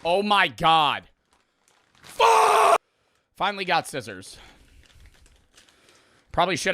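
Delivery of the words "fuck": high, loud curse bursting out; frustrated shout. Real, not acted. Streamer failing to open candy, real angry shout. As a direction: explode at the stubborn packet.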